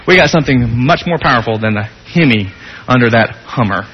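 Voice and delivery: normal talking voice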